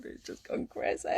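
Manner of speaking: breathy giggle as the words run out; overwhelmed little laugh; laughing in disbelief. Real, not acted. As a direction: laugh at how crazy it got. Overwhelmed by flood of messages.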